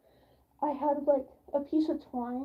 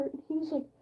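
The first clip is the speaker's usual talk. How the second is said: usual speaking voice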